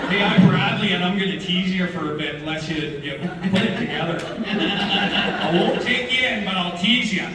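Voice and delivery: jokey Canadian lawman, laughing along; Canadian Ranger. Pretend. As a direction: play a genial ranger in a broad Canadian accent. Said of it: "Canadian Ranger".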